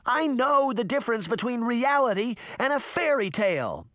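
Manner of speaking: put-on high, furious girl insisting; raging. A narrator's reading. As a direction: spit out her rant in anger. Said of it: "Sabrina raged".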